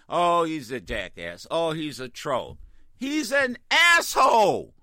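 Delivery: normal talking voice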